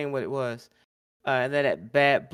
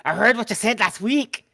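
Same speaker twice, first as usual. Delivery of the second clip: higher, louder imitation of Finn; imitating Finn; 6 semitones higher, 6 dB louder than usual. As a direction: do a loud impression of Finn. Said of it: "he was doing that".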